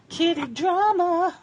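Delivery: high, slow, drawn-out exasperated cry; mock dramatic. Real, not acted. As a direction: make the cat fight sound like a soap opera. Cats suddenly fighting.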